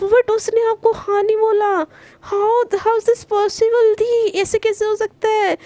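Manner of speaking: can't believe it, pitch up and drag it out; disbelief. Acted. Astonished sister, famous couple.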